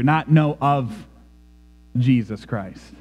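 usual speaking voice

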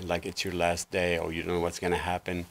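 normal talking voice

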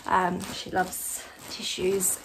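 usual speaking voice

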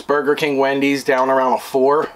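ordinary talk

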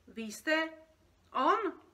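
loud, rising disbelief; astonished. Acted. Creature recognising the dreamer, astonished. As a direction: jump up high on the last word in amazement.

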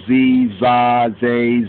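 normal talking voice

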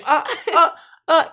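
high yelping cries of pain. Retold or imitated. Boy's shoulder being pulled, mock yelps of pain. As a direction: yelp like a hurt child.

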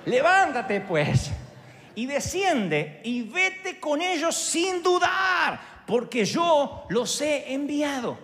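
the Spirit orders Peter to go; commanding shout. Pretend. (orden lenta y creciente, gritada)

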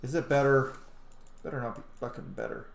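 usual speaking voice

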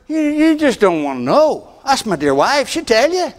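ordinary talk

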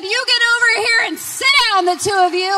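high, loud, bossy order; sit them down firmly; loud command. Retold or imitated. The daughter orders her mother and sister to sit, loudly.

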